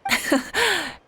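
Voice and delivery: soft, high little laugh; amused chuckle. Real, not acted. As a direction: chuckle at the teasing. Rit de la taquinerie.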